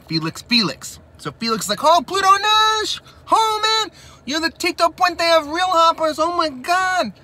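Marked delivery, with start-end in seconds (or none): 1.8-7.1 high, loud, slow squealing fanboy; geeking out fan. Retold or imitated. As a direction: gush like a starstruck superfan. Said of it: "he's geeking out".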